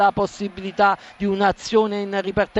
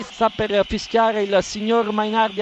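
ordinary talk, nothing marked

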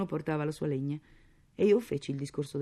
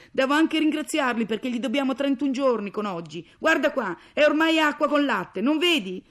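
loud, high exasperated tirade; angry nagging; 10 semitones higher, 11 dB louder than usual. Acted. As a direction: berate a husband over unpaid bills. Mother berates husband from the stove. (sfuriata esasperata, a gran voce)

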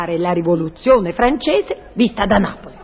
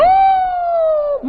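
sudden high shriek of alarm; high falsetto squeal. Acted. Carlotta caught out about stabbing Marat in the bath. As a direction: squeal like a startled lady. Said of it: "Carlotta's shocked squeal".

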